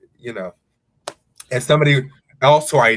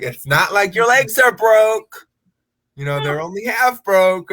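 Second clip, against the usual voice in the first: mock yell; 6 semitones higher, 3 dB louder than usual. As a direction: drag it out loud and playful. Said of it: "you'd be like".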